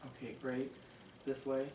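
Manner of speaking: ordinary talk, nothing marked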